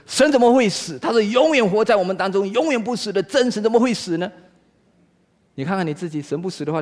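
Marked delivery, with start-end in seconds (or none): 0.1-4.3 high-pitched, indignant protest; mock outrage of an offended believer; acted anger. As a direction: object heatedly, pitch jumping up. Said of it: "马丁路德很生气地说".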